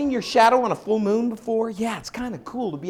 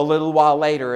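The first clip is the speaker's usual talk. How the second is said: ordinary delivery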